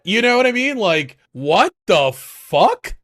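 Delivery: loud, high, drawn-out disbelief; exasperated outburst. Real, not acted. Ranting to his cohost about the internet, loud and drawn out. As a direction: throw it out loud and slow in disbelief.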